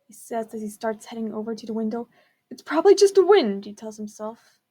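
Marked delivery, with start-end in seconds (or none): 2.5-3.7 louder and higher, forcing confidence; a raised, pitched-up brush-off; loud self-reassurance. Acted. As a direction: talk yourself brave out loud. Man reassures himself loudly.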